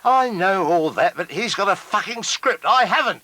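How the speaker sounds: rising high protest; indignant. Real, not acted.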